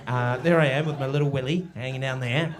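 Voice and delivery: ordinary talk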